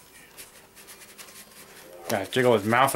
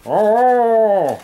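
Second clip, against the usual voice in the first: mouth propped open; 5 semitones higher, 5 dB louder, much slower than usual. Real, not acted. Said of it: "Really hard to talk with their mouth open".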